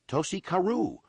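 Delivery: high, frantic cry of the name; frantic scream. A narrator's reading. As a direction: shriek it in panic. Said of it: "one of the men screamed frantically".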